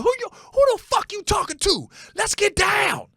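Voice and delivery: high-pitched mock challenge yell; mad stepdad. Son imitating his stepdad when he got mad, high hammed-up shouting. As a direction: shout it as a hammed-up brawler spoiling for a fight. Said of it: "he talked like a brother".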